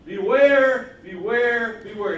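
very loud, high first cry, easing with each repeat; emphatic warning cry. Retold or imitated. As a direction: call out an urgent triple warning. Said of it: "You're doing it for emphasis".

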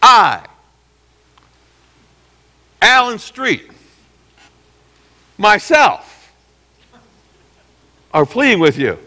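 high, earnest plea naming himself; pleading self-introduction. Retold or imitated. Pastor imagining defending himself to his church, high and earnest. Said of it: "That would be like me saying".

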